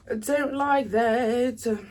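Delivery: slow, stretched-out complaint; drawn-out displeasure. Real, not acted. Reader repeats her complaint to viewers, slow and drawn out. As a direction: drag each word out in mock disgust.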